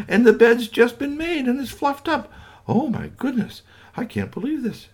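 very high, loud amazed gushing; astonished mother. Acted. Mother finding the spotless room, astonished and very high. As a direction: gasp at every tidy corner.

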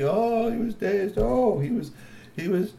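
high, slow drawn-out oh; savoring. A narrator's reading. Storyteller dwelling on the dazed human, high slow. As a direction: linger on how dazed he was.

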